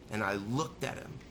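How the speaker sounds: normal talking voice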